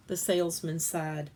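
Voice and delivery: ordinary delivery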